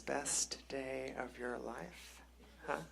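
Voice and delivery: slow, very soft, icy challenge; quietly angry. Acted. Angry wife to husband after the title win, hushed menace. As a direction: say it slowly under your breath, accusing. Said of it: "She's angry. She says".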